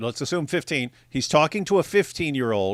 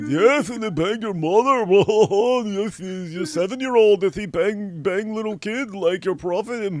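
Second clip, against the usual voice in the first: higher, broken-English mock voice; mocking impression; 6 semitones higher than usual. Host imitating Sam cursing the kid, higher mock. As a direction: parody the debater's ranting.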